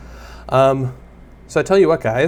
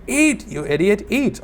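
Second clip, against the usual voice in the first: high-pitched, drawn-out mock scream; 9 semitones higher, slower than usual. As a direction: scream it in mock exasperation at a dim player. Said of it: "I would be screaming".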